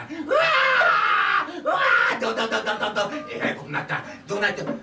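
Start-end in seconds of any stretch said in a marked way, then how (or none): 0.0-2.2 high, loud bawling cry; loud wailing. Acted. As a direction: howl like a small child. Fox cub wails again. (子狐が甲高く大泣きする)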